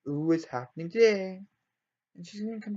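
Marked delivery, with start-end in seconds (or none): 0.1-1.4 louder, playful mock excitement; hammed-up happy anticipation; extremely excited. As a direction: act out an eager character's thrill. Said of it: "she's extremely excited".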